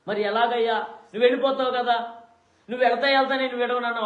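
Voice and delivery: high, loud, quick puzzled challenge; a lightly voiced doubting disciple; questioning disciple. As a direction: disciple pressing his teacher.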